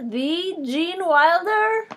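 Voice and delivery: high, loud, slow disbelief; surprised. Real, not acted. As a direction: stretch it out in disbelief. Disbelieving double-take at the name.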